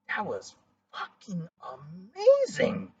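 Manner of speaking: slow, drawn out, then a high burst on amazing; mock amazement; stunned praise. Partner retelling stunned praise.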